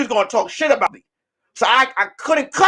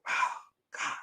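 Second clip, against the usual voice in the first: whisper; 17 dB quieter than usual. Retold or imitated. Whispered panic.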